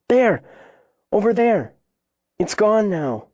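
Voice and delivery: put-on high, excited child's cries, easing by the end; urgent pointing out. A narrator's reading. Frightened girl points out cougar.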